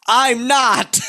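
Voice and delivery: high-pitched shouted protest, half laughing; loud, high retort played for laughs; panicked protest. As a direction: yelp it in comic panic. Acting out his panicked reply.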